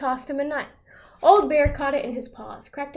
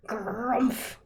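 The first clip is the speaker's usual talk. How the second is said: a lightly voiced bear grumble; low mock bear grunt; softer grumbling bear noise; growl; 3 semitones lower, 6 dB quieter than usual. A narrator's reading. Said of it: "growled Old Bear".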